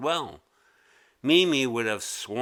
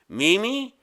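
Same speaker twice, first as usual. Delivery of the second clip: higher, louder scolding teacher; exasperated teacher; 8 semitones higher, 4 dB louder than usual. A narrator's reading. Teacher scolding Mimi for losing pencils, higher and louder. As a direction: scold her, fed up. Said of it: "he said".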